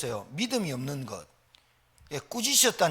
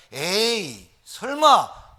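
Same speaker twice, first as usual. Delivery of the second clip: doubting scoff; 7 dB louder, much slower than usual. Retold or imitated. Disciples dismiss the resurrection news, loud mock scoff. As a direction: scoff as if it could never be. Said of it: "하고 내려왔다".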